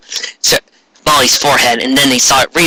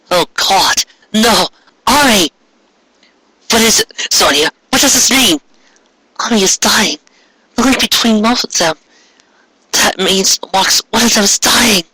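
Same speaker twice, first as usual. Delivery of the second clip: higher, urgent and scared; alarmed Sonya; 8 semitones higher than usual. Acted. Sonya realising Ami is dying, higher alarmed voice. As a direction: panic as you realise.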